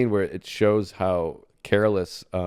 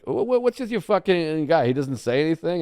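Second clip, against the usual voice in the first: imitating Patrice; 7 semitones higher than usual. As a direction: lightly do Patrice calling him out. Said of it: "Patrice was like".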